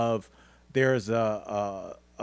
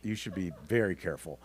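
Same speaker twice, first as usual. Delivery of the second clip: low, soft, quick caution; hushed warning; 6 semitones lower, 7 dB quieter, faster than usual. Real, not acted. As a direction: drop nearly to a whisper to warn a friend. Warns friend to be careful.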